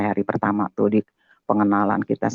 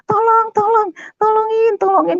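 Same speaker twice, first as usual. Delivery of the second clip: very high-pitched mimicked cries for help; screaming for help; 4 dB louder than usual. Retelling neighbour's cries from behind the house, screaming for help. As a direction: imitate a neighbour shrieking in panic. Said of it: "ada orang teriak-teriak".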